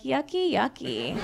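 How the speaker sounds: badly faked disgust, soft; half-hearted fake revulsion; bad acting. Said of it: "I'm a horrible actor".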